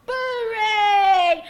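high, very loud winning holler; full-voiced victory cry; triumphant shout. A narrator's reading. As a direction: bellow the winning call, drawing out the last word. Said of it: "Boo shouted out".

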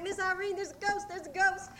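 high, urgent re-enacted alarm; frightened child calling. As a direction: cry out as a scared little girl. Retold scared child runs calling.

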